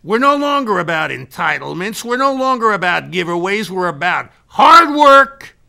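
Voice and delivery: loud, high, emphatic declaration; forceful lecture to an employee; rousing boss speech. Retold or imitated. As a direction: drive each point home, peaking on the third. Acted motivational speech to manager.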